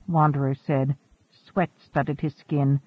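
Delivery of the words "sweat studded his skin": usual speaking voice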